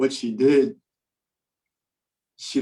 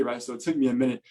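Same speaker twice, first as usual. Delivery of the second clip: usual speaking voice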